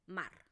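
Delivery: "mar" said clearly with an open A; "mar" con la A abierta; word example demo. Shown as a demonstration. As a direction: model the word "mar" for the class. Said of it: "por ejemplo, la palabra mar".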